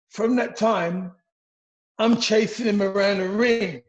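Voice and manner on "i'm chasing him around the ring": ordinary talk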